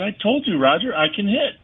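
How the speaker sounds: high-pitched, vindicated comeback; retold insistence. Player answers his manager in the office, retold insistence. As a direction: insist you were right all along, pitch up.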